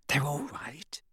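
hushed relieved whisper. A narrator's reading. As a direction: whisper it, soft and shaky. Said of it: "she whispered".

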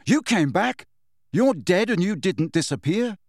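mildly heated protest; angry. A narrator's reading. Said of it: "said Harry angrily".

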